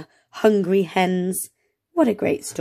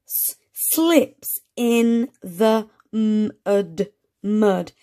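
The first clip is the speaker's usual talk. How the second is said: slow sounding out; much slower than usual. Shown as a demonstration. Teacher sounding out words for young readers, slow sounding out. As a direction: slowly sound out each word. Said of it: "Ant s-, or it p".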